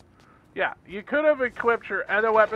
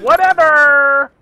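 loud, drawn-out exasperated whatever; 5 semitones higher, 13 dB louder, much slower than usual. Real, not acted. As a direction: throw it away loudly. Frustrated outburst.

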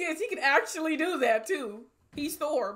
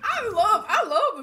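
laughing; 7 semitones higher, 11 dB louder, slower than usual. Real, not acted. Reactor delighted by fat Thor.

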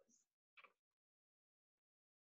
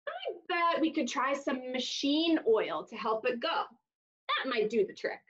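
plain reading voice

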